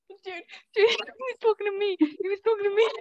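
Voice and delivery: high, loud, laughing excitement; real excitement. Real, not acted. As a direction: burst out thrilled, giggling and loud. Thrilled a stranger spoke to her.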